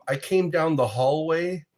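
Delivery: ordinary delivery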